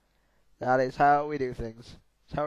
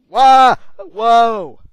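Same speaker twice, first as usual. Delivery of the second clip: high, loud surprised yelp; startled yelp; 10 semitones higher, 19 dB louder than usual. Real, not acted. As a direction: cry out as he's flung. Launched by ambulance.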